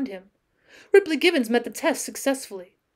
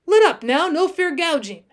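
raised, higher protesting call; 6 semitones higher, 6 dB louder than usual. A narrator's reading. Cowboy pinned under the lion, calling out. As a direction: call out a complaint mid-scuffle. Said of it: "He remembered calling out".

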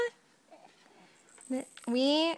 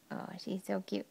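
ordinary talk

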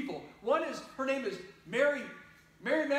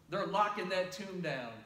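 usual speaking voice

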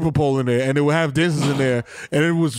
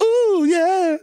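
high, put-on club-track vocal; lady vocal sample; 3 dB louder than usual. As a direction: imitate a sampled diva hook. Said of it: "a sample of a lady being like".

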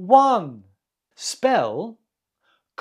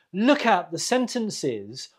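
ordinary delivery

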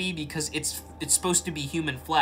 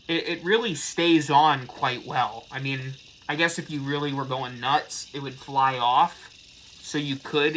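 muffled under latex mask; speaking inside full-head mask; through a face mask. Real, not acted. Seen 0: Full latex mask with wig covers the face throughout the labelled words.

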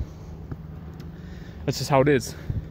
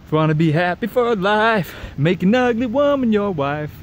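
mock sing-song rhyme; 8 semitones higher than usual. Men quoting the ugly-wife rhyme in a sing-song voice. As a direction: chant the old saying in a put-on voice. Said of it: "they go".

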